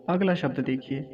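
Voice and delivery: ordinary talk, nothing marked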